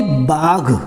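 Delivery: बाघ said as "baagh" with a gargled final gh; slow, careful "baagh" for बाघ; nukta gh sound. Shown as a demonstration. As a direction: draw out बाघ onto a throaty fricative. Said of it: "ग पे नुक्ता लग गया".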